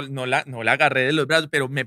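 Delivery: ordinary talk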